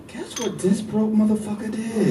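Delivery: put-on slow, scoffing girlfriend on the phone; gossiping girlfriend. Girlfriend calling friends about a cheap date, slow scoffing voice. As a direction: drag it out like she's dishing dirt. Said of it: "call her girlfriends up like".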